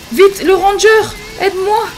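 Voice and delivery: high, loud cries for help; panicked calls. Pretend. Player begs the ranger to save Platoche, panicked calls. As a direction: call out urgently in mock panic.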